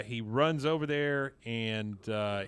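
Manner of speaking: ordinary talk, nothing marked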